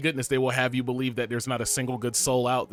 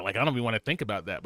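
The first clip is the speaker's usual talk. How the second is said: ordinary delivery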